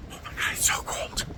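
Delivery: breathy whisper, freezing. Real, not acted. Vlogger whispering to camera about the bitter cold.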